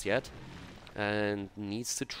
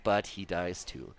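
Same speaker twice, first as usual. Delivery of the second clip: usual speaking voice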